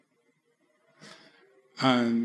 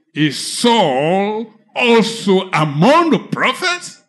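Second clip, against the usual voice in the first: mocking classmate; 5 semitones higher, 6 dB louder, slower than usual. Students mocking his sudden preaching.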